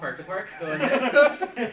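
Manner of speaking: laughing radio reply. Acted. Answering a radio call, laughing.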